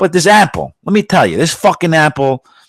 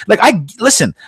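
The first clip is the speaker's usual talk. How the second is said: ordinary talk, nothing marked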